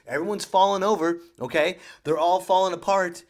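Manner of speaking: ordinary talk, nothing marked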